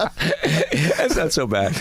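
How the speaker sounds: slow, laughing reassurance. Real, not acted. Host teasing the old player about his generation, laughing. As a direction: laugh it off slowly.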